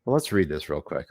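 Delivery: ordinary delivery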